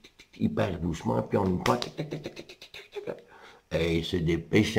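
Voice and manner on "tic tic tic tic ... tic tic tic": tic tic chuchotés comme des pas furtifs. Acted. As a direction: imite les pas du loup sur la pointe des pieds. Bruit de pas du loup. (petits pas chuchotés du loup)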